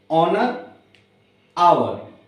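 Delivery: usual speaking voice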